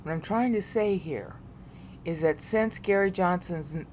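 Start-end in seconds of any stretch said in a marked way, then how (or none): none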